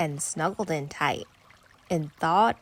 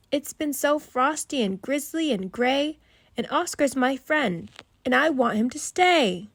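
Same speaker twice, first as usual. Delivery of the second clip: put-on pleading child; 6 semitones higher, 4 dB louder than usual.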